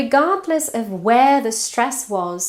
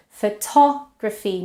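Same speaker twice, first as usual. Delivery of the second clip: "Photography" stressed on a full-vowelled -tog-; stress on second syllable. Shown as a demonstration. Teacher showing the vowel change, "Photography" stressed on -tog-. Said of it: "to is stressed and it has a full vowel".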